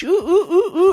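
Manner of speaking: high hooting ooh noises; spooky jungle calls; imitated spooky hooting. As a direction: hoot like a night bird in the jungle.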